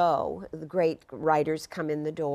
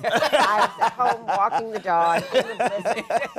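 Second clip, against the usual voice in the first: laughing; 4 semitones higher, 7 dB louder than usual. Real, not acted. Laughing about the blizzard.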